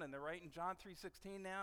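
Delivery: normal talking voice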